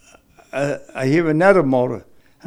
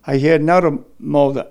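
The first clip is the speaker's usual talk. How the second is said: ordinary talk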